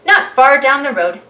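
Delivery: plain reading voice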